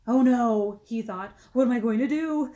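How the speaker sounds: put-on alarmed boy; mock horror at himself; panicked. Jason panicking.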